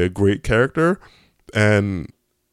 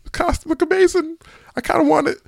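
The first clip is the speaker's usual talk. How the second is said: very high falsetto mock scream; fanboy squeal. Reviewer reenacting his reaction to seeing the costume, high-pitched squeal. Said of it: "I screamed a little bit".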